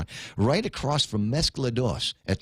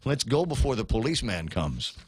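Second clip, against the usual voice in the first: ordinary talk, nothing marked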